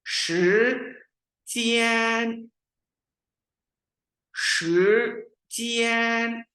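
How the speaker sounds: slow, high शिजियान with rising then level tone, stretched; teacher drilling second tone into first tone; Mandarin tone drill. Shown as a demonstration. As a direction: model it for students to repeat. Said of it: "इसको अदा करते हैं".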